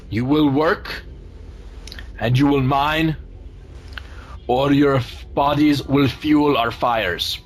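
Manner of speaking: slow, booming giant, lightly voiced; a hint of a commanding giant's yell; fire giant. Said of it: "yells out".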